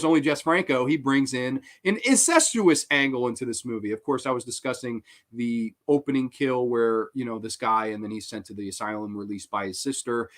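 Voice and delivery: ordinary delivery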